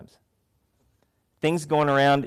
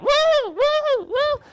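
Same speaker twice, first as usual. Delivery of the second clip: very high squealing cartoon woo; shrieking cartoon panic, way up high; loud high-pitched cartoon shriek; SpongeBob woo; 4 dB louder, much slower than usual. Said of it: "just starts running around".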